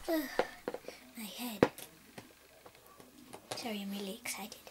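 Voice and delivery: soft unvoiced quick apology; hushed and sheepish; whispered apology. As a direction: whisper sorry, wincing.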